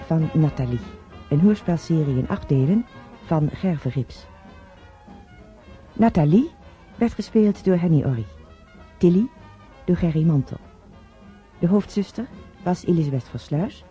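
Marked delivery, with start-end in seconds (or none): none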